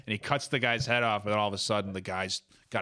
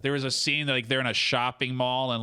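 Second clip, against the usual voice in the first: usual speaking voice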